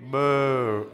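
drawn-out, mildly gloomy boo; sad boo. Shown as a demonstration. Preacher rehearsing the sad emoji response, drawn-out sad boo. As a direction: stretch a mock-sad boo. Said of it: "Sad emoji".

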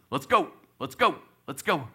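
referee calling out. Retold or imitated. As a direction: hurry the kid along. Referee calling to resume play.

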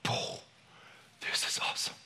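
whispered mock wonder; hushed awe. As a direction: whisper it like you've just found treasure. Acting out finding a forgotten gift.

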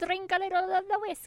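high, put-on Irish leprechaun brogue. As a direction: play a cartoon leprechaun in a pub. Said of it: "I don't think that was Irish".

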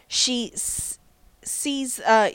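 ordinary talk

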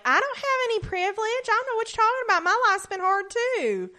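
high, fast mocking whine. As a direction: sneer it out as a clueless complainer. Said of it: "being like".

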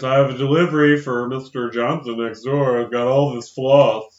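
mock an unconvincing camp disguise, drawing out the end; campy put-on accent. Posing as a delivery man with floss, hammed-up camp voice. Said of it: "He starts talking like this".

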